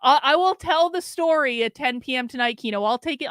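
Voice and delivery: ordinary talk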